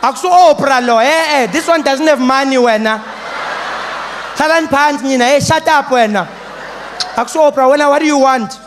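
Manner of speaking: high, loud frantic scolding; teacher losing it. Acted. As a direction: scold the class at full volume. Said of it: "Teacher lost her mind".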